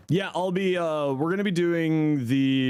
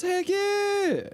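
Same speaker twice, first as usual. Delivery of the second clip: excited squeal. Real, not acted. Fixed a chatter's build.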